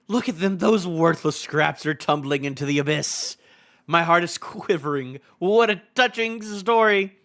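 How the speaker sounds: theatrical villain relishing the doom; hammed-up evil mockery with mock tenderness; gloating villain. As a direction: sneer grandly at the victims, savoring each phrase. Reads a gloating villain's lines.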